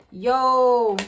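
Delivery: loud, drawn-out call; imitated phone greeting. Side dude picking up a FaceTime call, loud drawn-out greeting. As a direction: hold it long and loud.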